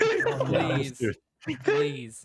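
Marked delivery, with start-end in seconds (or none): 0.0-1.0 high squealing laugh; laughing plea. Real, not acted. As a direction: beg while laughing. Begging through laughter.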